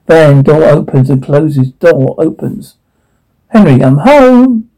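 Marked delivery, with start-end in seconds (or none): 3.5-4.7 higher, drawn-out homecoming call. Acted. Arriving home and letting Henry know, calling out. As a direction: call it slowly through the house.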